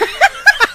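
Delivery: squealing high laughter; laughing at the memory. Real, not acted. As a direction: burst out laughing. Laughing mid story.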